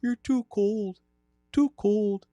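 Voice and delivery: put-on high-pitched Wisconsin hockey accent; high sing-song Midwestern creep. Holding the Ed Gein character.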